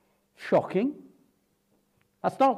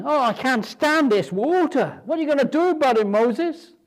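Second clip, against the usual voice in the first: higher, louder mock moan, rushing at the end; moaning crowd; 9 semitones higher, 5 dB louder than usual. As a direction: whine at Moses like a fed-up crowd. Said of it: "they're moaning".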